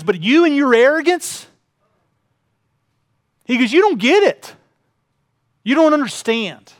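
a father's indignant rebuke; angry dad. Retold or imitated. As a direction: retell the father's anger with force. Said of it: "what made my dad most angry".

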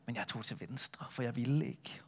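hushed, guilty whisper; whispered confession. Acted. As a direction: breathe it out like a confession. Jonas confesses he refused.

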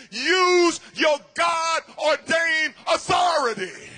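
high, loud and slow, each word hammered; booming pulpit command; full preaching cry. Acted. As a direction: thunder God's command at the church. Preached quote of God.

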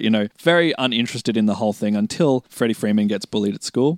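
ordinary talk, nothing marked

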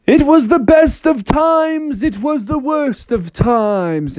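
high, slow declamation; Froggy reading aloud. Acted. Froggy reads aloud from inside a whirlwind, high declaiming. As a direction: proclaim the line slowly and grandly. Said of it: "he read the opening line of that book".

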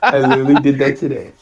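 loud, laughing confession. Real, not acted. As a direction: laugh through the words. Laughing reply.

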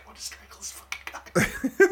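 high, unvoiced seething whisper; furious whisper. Fuming at the obnoxious guy, seething whisper. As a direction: seethe it under your breath. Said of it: "I was like".

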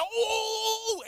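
long, high, loud "ooooh"; pick-me squeal, drawn out high; eager "ooh". Acted. Kid begging teacher to be called on, eager ooh. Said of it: "you start raising your hand like".

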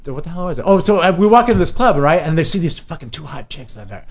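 ordinary delivery

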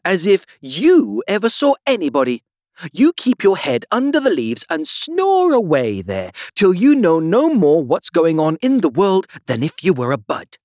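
loud, high scolding tirade; scornful telling-off; indignant cry. A narrator's reading. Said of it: "cried the Tiger Lily".

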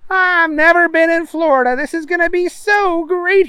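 high, eager Booger voice; excited. Acted. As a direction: gush with excitement. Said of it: "he was excited".

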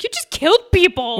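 mock scolding; mock accusation. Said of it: "He's like".

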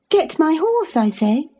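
high, with horse rising impatiently; impatient command. A narrator's reading. Cathy repeats her order to Hareton, impatient. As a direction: repeat the order, annoyed at being ignored.

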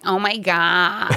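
put-on a slow, gushing North American accent; mock North American accent, drawn out. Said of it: "try to do a North American accent".

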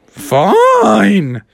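high, drawn-out, exasperated concession; grudging surrender. Acted. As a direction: give in with bad grace. Beaten bull gives in grudgingly.

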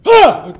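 high loud yelp of fright; startled yelp. Acted. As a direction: jump out of your skin with a yelp. Startled bartender.

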